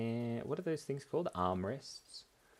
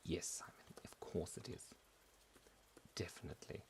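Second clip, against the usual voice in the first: much softer, near-whispered reply; hushed answer; 5 semitones lower, 15 dB quieter than usual. Pretend. Answering himself about his terrible accent, hushed. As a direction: drop to a whisper to answer yourself.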